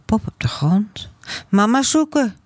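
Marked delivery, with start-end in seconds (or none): 1.5-2.4 put-on high, childlike announcement; little girl's voice. A narrator's reading. Toddler wanting to find mama, high little voice.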